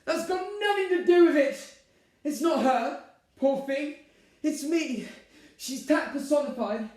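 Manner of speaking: very high, loud protest; heated rebuttal. Acted. Bride defending her stepmother to groom, heated. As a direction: argue shrilly and end on an order. Catherine argues back.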